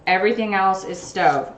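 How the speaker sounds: ordinary talk, nothing marked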